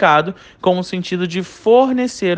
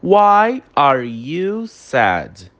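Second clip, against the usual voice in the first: slow dictation; much slower than usual. Shown as a demonstration. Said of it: "olha, nós temos".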